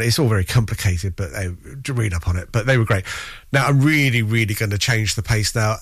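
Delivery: ordinary talk, nothing marked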